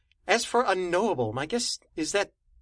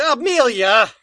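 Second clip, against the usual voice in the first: shouting her name; calling out; 4 semitones higher, 8 dB louder than usual. Acted. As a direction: call out loud across the room. Character calls out a name.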